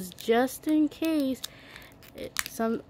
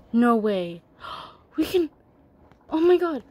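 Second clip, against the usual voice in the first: real excitement; 6 dB louder than usual. Real, not acted. Girl unboxing her toy, real excitement. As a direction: blurt it out like you can't believe your luck. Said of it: "I'm so excited".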